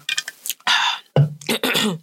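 clearing throat after hot tea. Real, not acted. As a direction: cough it clear after a scalding sip. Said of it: "Ooh, when the tea too hot".

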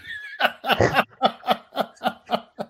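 high cackling laugh at his own joke; big laugh. Real, not acted. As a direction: burst out laughing.

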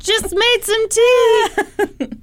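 high, slow sing-song welcome; fake friendly host. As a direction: lure them in sweetly. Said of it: "the undercover cop".

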